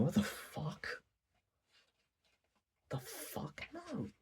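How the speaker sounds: rising, fading to a hushed high no; stunned disbelief. Retold or imitated. Reader reacting to Yamai's threat, fading incredulous. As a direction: trail off in shocked disbelief. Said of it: "You're thinking".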